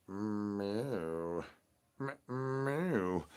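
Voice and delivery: soft, quiet mewing cat; cat meow. Pretend. Cat answering the El Wushy call, soft meows. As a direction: mew back like a shy cat.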